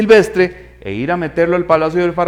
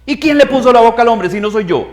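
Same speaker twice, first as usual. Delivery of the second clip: louder, higher, fast scolding; angry God; 6 semitones higher, 4 dB louder than usual. Retold or imitated. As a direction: scold the excuse-maker firmly. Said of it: "Dios se enoja y le dice". (Dios regañando a Moisés, enojado y fuerte)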